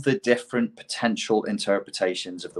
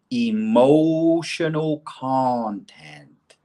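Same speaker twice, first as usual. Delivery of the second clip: very slow, drawn-out EMOTIONAL CONTENT; "emotional content" held long like a master's maxim; Bruce Lee; 4 semitones higher, much slower than usual. Acted. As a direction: stretch out "emotional content", raised and emphatic. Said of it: "He said, "Deliver the movement with".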